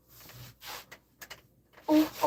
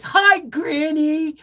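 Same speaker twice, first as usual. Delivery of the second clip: put-on toddler voice; 12 semitones higher, 7 dB louder, much slower than usual. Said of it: "he says".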